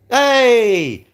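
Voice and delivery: loud, high "Hey!" called out; sudden shouted hey. Real, not acted. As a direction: bellow it to get attention. Sudden call-out on stream.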